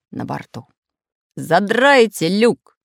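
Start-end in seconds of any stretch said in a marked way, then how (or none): 1.4-2.8 slow, loud order; commanding captain. Acted. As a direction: bark the command slowly. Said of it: "скомандовал Самоделкин".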